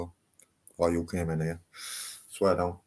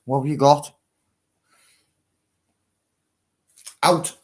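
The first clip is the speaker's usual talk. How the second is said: firm dog command; 5 semitones higher, 7 dB louder than usual. Real, not acted. Dog has something in mouth.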